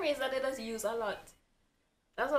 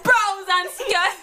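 slow drawn-out laughing Jamaican phrase; Jamaican accent; 9 semitones higher, 10 dB louder, much slower than usual. Pretend. Friend asked for Jamaica, slow laughing Jamaican phrase. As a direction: stretch it out slowly in patois.

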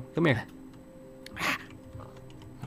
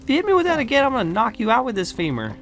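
high, raised-voice warning; annoyed threat; 8 semitones higher than usual. Acted. Friend just struck with a bone, annoyed threat. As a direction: snap a threat after being hit. Threatens after being hit.

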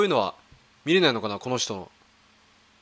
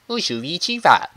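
playful fake Chinese sounds, a bit higher and louder; mock Chinese gibberish; 4 semitones higher, 4 dB louder than usual. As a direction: toss off nonsense syllables like an imitation of Chinese. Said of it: "中国語ってなんかそんな感じのイメージがある". (中国語っぽいでたらめな音の物まね)

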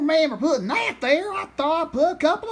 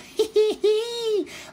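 gloating cackle; 8 semitones higher than usual. Acted. Farmer gloats over catching turkey.

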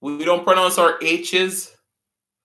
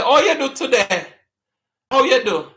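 higher patois "Oh, you do today"; Jamaican patois greeting; 6 semitones higher than usual. Shown as a demonstration. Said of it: "someone walk up to you and they say".

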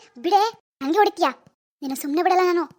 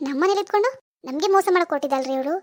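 ordinary delivery

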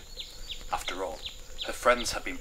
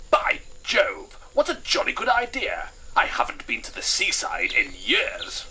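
high, loud, jolly colonel; jolly colonel bursting with delight; 12 semitones higher, 6 dB louder than usual. Acted. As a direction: exclaim like a thrilled colonel.

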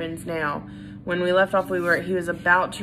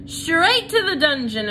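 high, loud angry shout; crowd shout; 9 semitones higher, 5 dB louder than usual. A narrator's reading. Another mouse yells for the dungeon, loud angry shout. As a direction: yell for punishment. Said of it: "another voice shouted".

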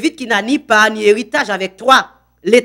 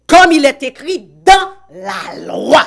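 preached quote; 5 semitones higher, 4 dB louder than usual. Acted. Citation prêchée de l'Écriture.

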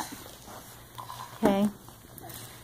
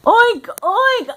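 pig oinks; 9 semitones higher than usual. Retold or imitated. Mother oinks for toddler.

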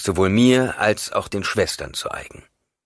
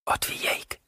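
breathless stunned whisper; whispered name, frightened; 6 dB quieter than usual. A narrator's reading. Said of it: "flüsterte sie".

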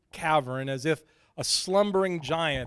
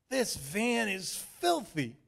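Shane complaining; 6 semitones higher, slower than usual. A narrator's reading.

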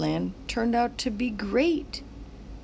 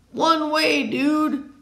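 louder, teasing call; louder one way dude; mock car warning; 6 semitones higher, 6 dB louder, much slower than usual. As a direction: warn like a car honking. Car voice in story.

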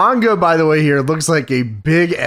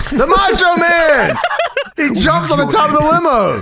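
big excited wrestling reveal, much higher than usual; excited announcing; 12 semitones higher than usual. Acted. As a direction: announce the surprise entrance at a high pitch.

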